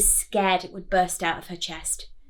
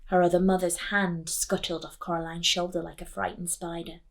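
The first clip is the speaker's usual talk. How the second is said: plain reading voice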